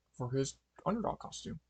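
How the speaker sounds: ordinary delivery, nothing marked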